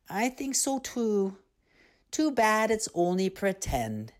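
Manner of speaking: put-on high child's voice, a touch wistful; a sleepy child regretting it is pretend; second little mergirl. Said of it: "sighs the other".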